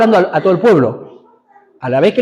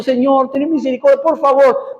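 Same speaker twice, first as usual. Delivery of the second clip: higher-pitched mock plea for mercy; imitated pleading prayer; 4 semitones higher, slower than usual. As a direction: imitate someone begging God in prayer. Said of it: "no orás diciendo".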